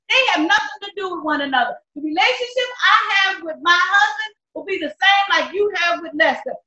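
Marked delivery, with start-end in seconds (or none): none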